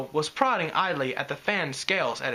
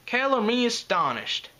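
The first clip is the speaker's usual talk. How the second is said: mock astonishment, pitched up; deadpan sarcasm with a lifted pitch; dry remark; 8 semitones higher than usual. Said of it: "Applejack said dryly".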